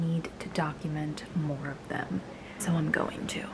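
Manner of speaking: soft unvoiced whisper, not to wake them. Real, not acted. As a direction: hush it so the kittens sleep on. Whispering near sleeping kittens.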